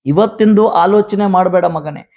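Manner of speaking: rousing sermon delivery, rising and driving; fervent preaching. Real, not acted. Pastor preaching to the congregation about God's gifts, fervent. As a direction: preach it to the believers with conviction.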